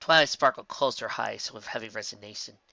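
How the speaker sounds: ordinary delivery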